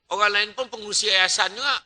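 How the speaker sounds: normal talking voice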